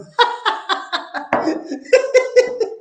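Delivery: high burst of laughter. Real, not acted. Risa tras broma del diablo. (carcajada aguda)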